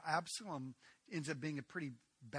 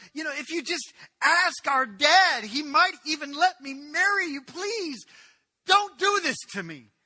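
high, loud, imploring plea; desperate begging; 12 semitones higher, 18 dB louder than usual. Acted. Tamar offers to marry Amnon, frantic loud begging. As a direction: plead with him as a last hope.

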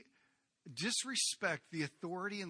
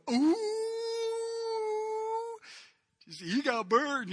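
much higher mocking ooh, slowed; teasing ooh; 4 dB louder, much slower than usual. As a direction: tease a friend who just got burned. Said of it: "I can imagine the others going".

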